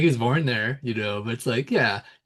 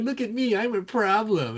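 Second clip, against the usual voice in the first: mocking Usman's boast; 9 semitones higher than usual. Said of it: "he's like".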